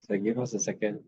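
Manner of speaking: distant, away from the microphone; faint off-mic chatter. Real, not acted. Mic trouble, talking away from mic.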